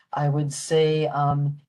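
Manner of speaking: usual speaking voice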